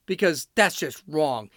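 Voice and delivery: ordinary delivery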